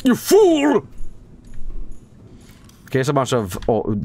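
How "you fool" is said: high, loud-ish cry of scorn; mock outrage. Gamer scolds mid-game, mock outrage. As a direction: cry it out like a melodramatic rebuke.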